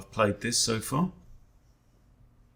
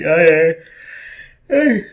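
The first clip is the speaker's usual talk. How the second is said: words stretched by a big yawn; loud yawn breaking the words; 7 semitones higher, 12 dB louder, slower than usual. Real, not acted. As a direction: yawn right through it. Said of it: "don't know why I'm yawning".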